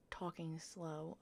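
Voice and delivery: slow, unhurried voiceover drawl; talking slowly. Real, not acted. Gamer narrating a Minecraft clip, speaking slowly. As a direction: let the words stretch out lazily. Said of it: "I'm talking really slowly".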